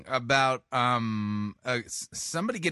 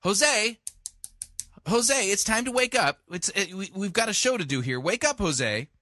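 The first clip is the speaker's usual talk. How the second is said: high raised call to a sleeping bird; calling to wake him; 7 semitones higher than usual. Acted. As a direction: wake up, Jose, it's showtime. Waking the Tiki Room bird.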